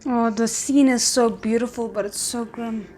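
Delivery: ordinary talk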